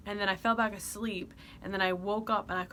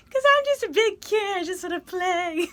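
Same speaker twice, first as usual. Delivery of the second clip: put-on childish squeal; 10 semitones higher, 10 dB louder than usual.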